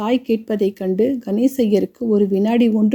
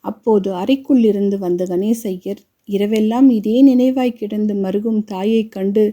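plain reading voice

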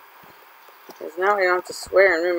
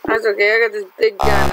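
ordinary delivery

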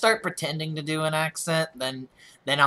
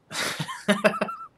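real squeaky giggling burst; high-pitched laugh. Real, not acted. Laughs after joke about Australians.

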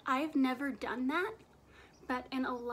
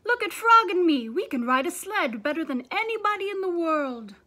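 high, loud showing-off; boasting; 4 semitones higher, 13 dB louder than usual. Acted. Toad boasting to the crow, loud and excited. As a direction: brag to the crow at full volume.

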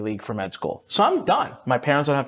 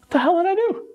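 baffled. Retold or imitated. Me reacting to friend in library, high baffled squeak. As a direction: squeak out the confusion. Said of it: "I was like".

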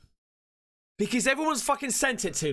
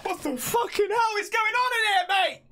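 real startled shout; shocked shout; 11 semitones higher than usual. Real, not acted. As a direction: bellow it at the screen in disbelief. Shocked by horror clip.

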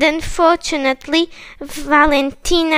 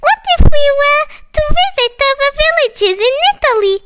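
high, loud, very fast eager voice; much higher and rushing with excitement; excited proposal; 12 semitones higher, 5 dB louder, much faster than usual. Acted. Big Penny proposing a trip to Italy, high and excited.

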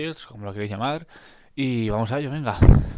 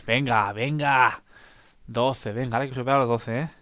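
ordinary delivery

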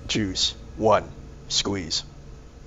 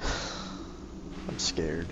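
breathy frightened whisper; scared whisper; 8 semitones lower, 16 dB quieter than usual. Real, not acted. Frightened in horror game.

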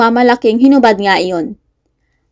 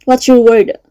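threatening. A narrator's reading. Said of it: "Banta niya dito".

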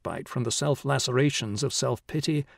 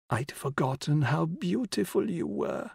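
put-on old woman whispering; 4 semitones higher, 4 dB quieter than usual. A narrator's reading. Dying old woman voiced.

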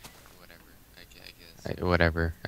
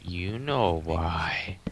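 whisper; 8 dB quieter, much slower than usual. Acted. Teasing friend during game chat.

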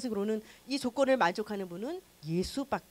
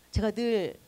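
ordinary talk

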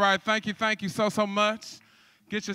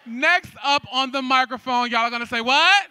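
loud prompt rising to the final word; call-and-response cue; 6 dB louder than usual. Shown as a demonstration. Host rehearsing the crowd's response, loud cue. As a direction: cue the crowd to shout back. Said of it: "when I say".

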